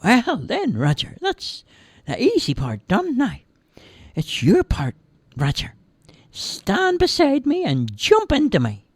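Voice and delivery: chirpy guide giving instructions; tiny man voice. Acted. Tiny man tells Roger to jump in, high.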